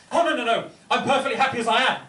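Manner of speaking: defensive. Retold or imitated. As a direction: brush it off quickly, defensive. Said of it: "is the defensive response I get".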